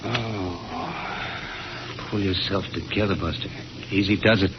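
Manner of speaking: calm yourself under your breath; muttering to himself. Acted. Steadying himself alone after shock.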